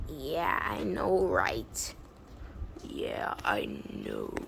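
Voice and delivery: low, soft sleepy mumble; tired agreement. Acted. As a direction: agree as if nodding off. Tired friends agree before sleep.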